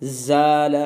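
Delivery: ज़ाल with a light, thin alif; thin alif demo. Shown as a demonstration. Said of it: "तो बारीक".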